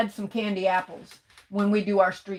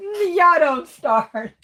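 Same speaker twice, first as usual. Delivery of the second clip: much higher, louder, slow playful warning through a laugh; mock warning; 7 semitones higher, 7 dB louder, much slower than usual. Real, not acted. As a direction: wag a finger at the chat, laughing. Teasing chat about City Trends.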